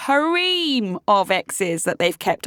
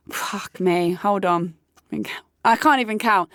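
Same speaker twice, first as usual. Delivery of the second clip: ordinary talk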